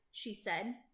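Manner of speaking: plain reading voice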